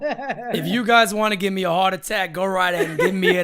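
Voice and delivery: loud laughing rant. Real, not acted. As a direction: laugh through the words. Laughing about Yankees rumors.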